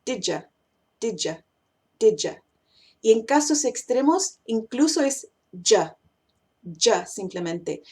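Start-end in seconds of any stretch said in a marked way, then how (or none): 0.0-2.4 "did ya" linked as "di-jah"; modified you. Shown as a demonstration. Teacher modifying you in the example, "did ya" as "dija". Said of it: "Ahora modificando you". 5.5-7.1 "ya" said as "jah", all that is left of did you; extreme reduction. Shown as a demonstration. Teacher showing extreme cases, just "jah". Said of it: "No digo la primera D".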